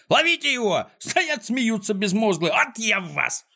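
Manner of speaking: high, threatening holler; outraged high-pitched villain; angry yelling. A narrator's reading. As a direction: bawl orders at gawping workers. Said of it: "заорал Клопс".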